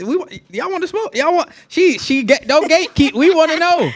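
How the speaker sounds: high, loud, playful pleading; hyped up. Real, not acted. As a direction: hype her up to spill it. Hyping her to spill the tea.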